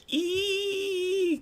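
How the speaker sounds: very high excited squeal; high squeal of excitement. Real, not acted. Fan hearing Seth Rollins' music squeals with excitement. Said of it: "I was also like".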